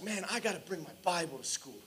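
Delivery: softly voiced private resolve, in passing; quiet musing. Young convert deciding to himself, quiet musing.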